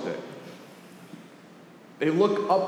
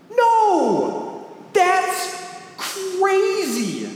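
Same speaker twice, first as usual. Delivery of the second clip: incredulous outburst; 11 semitones higher, much slower than usual. Real, not acted. Pastor rejecting his own absurd idea.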